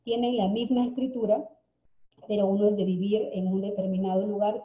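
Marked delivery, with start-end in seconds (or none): none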